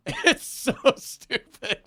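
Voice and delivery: breathless laughing high. Real, not acted. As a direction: wheeze it out through laughter. Laughing at a silly fight.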